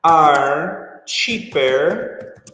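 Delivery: very slow, drawn out are cheaper; teacher stretching out the key phrase, very slow; slow emphasis. Shown as a demonstration. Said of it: "slow teaching emphasis".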